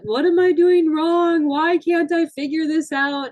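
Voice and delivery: crying out in frustration; upset teen. Retold or imitated. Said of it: "listening to him cry".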